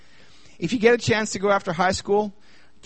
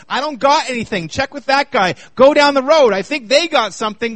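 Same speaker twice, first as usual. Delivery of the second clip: louder, higher preaching voice; full-force preaching; 5 semitones higher, 5 dB louder than usual. Acted. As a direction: drive the point home to the congregation.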